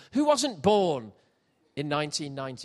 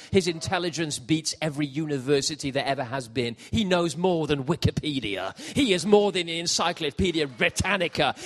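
normal talking voice

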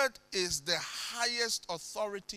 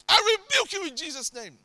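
loud, high re-enacted command; high-pitched commanding shout; shouted rebuke; 10 semitones higher, 11 dB louder than usual. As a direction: cry out a rebuke at intruders. Retelling his rebuke of armed robbers.

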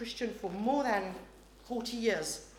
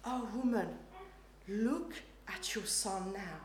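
tender; 8 dB quieter than usual. Retold or imitated. Dying Jesus speaking to his mother, soft and tender. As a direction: speak softly to a grieving mother. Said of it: "what he says is".

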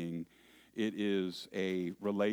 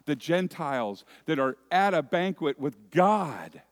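preaching at full force; 5 semitones higher, 7 dB louder than usual. Acted. Preacher to his congregation, rising to full force. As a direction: drive the picture home to the hearers.

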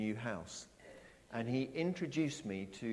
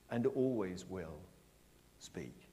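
ordinary talk

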